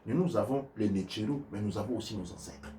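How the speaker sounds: ordinary talk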